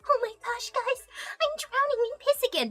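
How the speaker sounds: mock little pony voice; cutesy pony voice. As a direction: squeak it like a cartoon pony. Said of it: "bring it on stream and be like".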